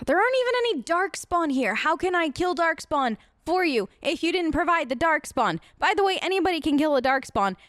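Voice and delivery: ordinary talk, nothing marked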